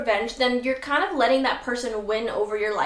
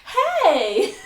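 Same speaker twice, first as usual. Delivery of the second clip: high, loud, overly chummy hey; mock the sudden fake friend; fake friendly greeting; 10 semitones higher, 7 dB louder, much slower than usual. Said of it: "they're like".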